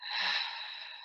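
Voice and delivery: long, soft audible breath; comfortable breath. Shown as a demonstration. Meditation leader breathes along before relaxing the body, comfortable breath. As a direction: let a slow breath go. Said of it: "So breathe comfortably now".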